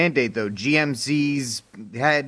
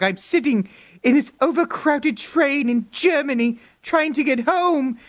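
mock Greta whine; 13 semitones higher than usual. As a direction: whine like a pampered traveller. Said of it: "She was like".

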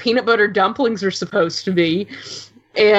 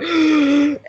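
higher mock-tearful gasp; emotional gasp; 6 semitones higher than usual. Fan re-enacting her reaction to the scene, overcome gasp. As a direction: gasp as if about to cry.

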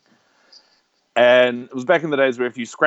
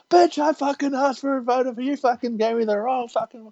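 high-pitched imitation of an irate customer; mock screaming rant; 9 semitones higher than usual. Irate customer arguing back at the clerk, mock screaming. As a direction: pitch it way up, hammed-up outrage. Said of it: "bitching and yelling and screaming".

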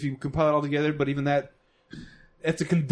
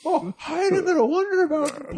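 high goofy manchild voice; squeaky unhinged comic voice; crazy voice; 14 semitones higher than usual. Retold or imitated. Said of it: "in a crazy voice".

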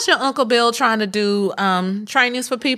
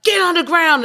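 barked order; 8 semitones higher, 4 dB louder than usual. Retold or imitated. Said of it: "they were like".

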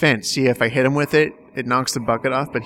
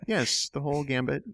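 ordinary talk, nothing marked

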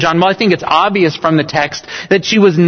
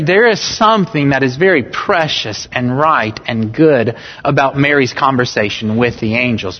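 normal talking voice

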